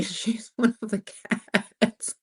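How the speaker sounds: reading through laughter; real laughter. Real, not acted. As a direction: crack up while slowly getting the words out. Cracks up reading a comment.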